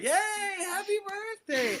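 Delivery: very high, slow drawn-out cheer; crowd erupting. Retold or imitated. Passengers cheering Nadine's supposed birthday, mock crowd eruption. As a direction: imitate a whole cabin cheering, laughing. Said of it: "everyone erupts".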